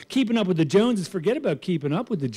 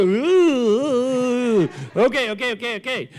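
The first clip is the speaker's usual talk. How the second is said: mock sheepish; 5 semitones higher than usual. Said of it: "And I go".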